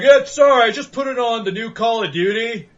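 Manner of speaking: mocking a new GameStop clerk who messed up the order, loud and high. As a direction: play the new guy who botched it, overeager and loud. Mocking clumsy clerk's apology.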